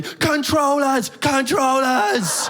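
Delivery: high, slow shout of alarm. Retold or imitated. Man at front warns the bus, shouting high and drawn out. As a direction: call out the warning slowly. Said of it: "he just shouts down to everybody".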